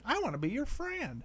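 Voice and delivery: high-pitched talking doll voice. Retold or imitated. A doll in the film suddenly speaks, in a high doll voice. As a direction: squeak it out like a talking toy. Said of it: "the doll starts saying".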